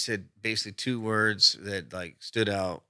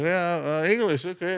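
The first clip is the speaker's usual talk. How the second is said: higher-pitched easygoing reply; mimicking prank victim; 8 semitones higher, 3 dB louder than usual. Prank victim answering while walking, mimicked higher. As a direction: play an unsuspecting passerby, pitched up.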